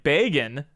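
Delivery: "Bagan" opening like bagel; long A, like bagel. Shown as a demonstration. Streamer compares name sounds, "Bagan" said BAY-gin. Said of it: "it sounds like bagel".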